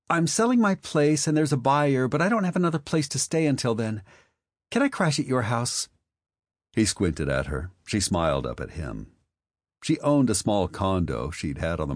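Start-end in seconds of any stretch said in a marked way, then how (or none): 0.1-5.9 higher, quick, pleading sweet talk; a much higher voice, rushing the ask; high, wheedling Marley voice. Acted. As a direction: coax a favor out of an old friend.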